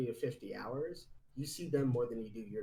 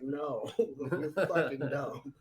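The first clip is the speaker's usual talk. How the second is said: laughing dismissal; 3 semitones higher, 5 dB louder, much slower than usual. Retold or imitated. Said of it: "he was just like".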